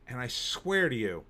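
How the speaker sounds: ordinary delivery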